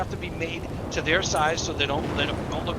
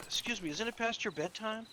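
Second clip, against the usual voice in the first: softly, a little higher, teasing; hushed, higher-pitched polite needling; mock concern; 5 semitones higher, 12 dB quieter than usual. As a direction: ask it gently as if to a child. Teasing small cousin as a child.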